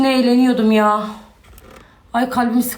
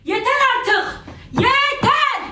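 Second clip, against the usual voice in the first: high, sharp, near-yelled "Yeter"; exasperated cry; 12 semitones higher, much slower than usual. Real, not acted. Fed up with friends' gossip, high exasperated cry. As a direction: cut them off, high and at the end of her rope.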